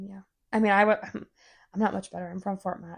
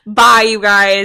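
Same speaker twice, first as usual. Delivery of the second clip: loud goodbye; 15 dB louder, slower than usual. Pretend.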